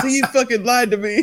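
high, laughing fake outrage; laughing mock accusation. Podcaster retells confronting his lying friend, laughing. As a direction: accuse him while cracking up. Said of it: "You were mad".

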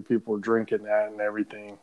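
ordinary talk

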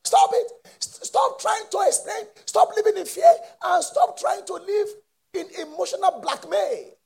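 very high, loud rebuke; commanding shout. Acted. Preacher ordering the congregation to stop.

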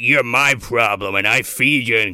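lightly voiced bossy father; stern dad voice. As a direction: lay down the law like a dad. Dad voice in retold argument.

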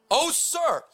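high, slow panicked servant; young servant crying out in fear to Elisha; frightened cry. Acted. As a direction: cry out in fear to your master. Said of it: "the young servant cried to Elisha".

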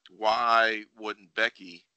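ordinary talk

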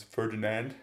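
Ferdinand with an American R, no roll. Shown as a demonstration. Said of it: "you could say just like Americans".

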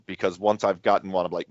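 normal talking voice

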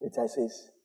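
ordinary talk

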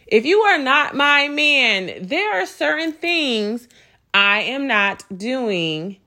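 slow, measured, every word weighted; emphatic repeat. Real, not acted. Repeating herself about what she won't do for him, slow and emphatic. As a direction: repeat it slowly, spacing out each word. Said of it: "let me repeat myself".

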